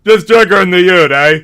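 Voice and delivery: loud, high ocker twang; shouted Aussie bogan accent; Bogan Australian. Pretend. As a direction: belt it like a bloke in the Outback. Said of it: "okay, the title is".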